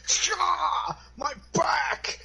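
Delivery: very high hammed-up yelp; mock pain cry. Acts being axed in the back.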